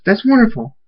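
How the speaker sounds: high-pitched congratulation; delighted. A narrator's reading. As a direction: rejoice for a friend. Congratulates on pregnancy.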